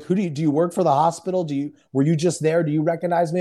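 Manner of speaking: ordinary delivery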